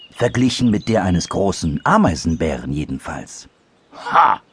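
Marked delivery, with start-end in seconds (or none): 4.1-4.4 sudden louder, higher "Ha"; calling out. A narrator's reading. Big anteater objecting to dormouse, calling out. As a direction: burst out with it as the anteater. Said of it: "rief der große Ameisenbär noch einmal".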